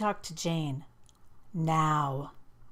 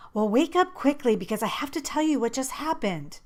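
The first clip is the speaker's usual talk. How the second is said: excited; 6 semitones higher, 3 dB louder than usual. A narrator's reading. Excited narrator urging friend.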